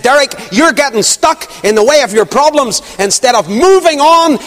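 high, urgent exhortation in the elder's voice; Alec Easton. Retold or imitated. Elder exhorting the stuck preacher, imitated high and emphatic. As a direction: urge him on high and forceful, like the old Scotsman. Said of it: "I can hear him yet".

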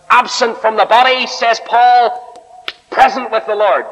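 high, ringing scripture quotation; emphatic proclamation. Real, not acted. Preacher quoting Paul to the congregation, high emphatic proclamation. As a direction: proclaim it from the pulpit, pitched high.

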